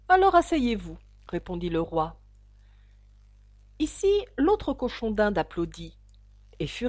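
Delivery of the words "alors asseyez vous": high, loud order; curt royal command; commanding king. A narrator's reading. The king orders the hatter to sit, imperiously.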